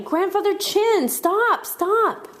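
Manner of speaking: high, urgent calling out; shouting on the run. A narrator's reading. Kids running uphill call to grandfather, high urgent shouts. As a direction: cry out very high to be heard. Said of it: "everybody running and shouting".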